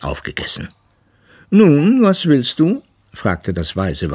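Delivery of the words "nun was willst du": hohe, laute Walrossstimme, gedehnt fragend; weises Walross, mürrisch. A narrator's reading. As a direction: voice a grumpy old walrus, pitched high and loud. (mürrisches Walross, hoch und laut)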